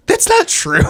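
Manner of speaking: very high mock-indignant woman; high-pitched mocking imitation; offended woman. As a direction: squeal it like an offended friend. Mimicking offended Laura in a story.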